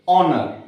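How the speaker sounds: "ऑनर" starting on the vowel; "ऑनर", H dropped completely; silent H. Shown as a demonstration. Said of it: "एच को silent छोड़ते हैं".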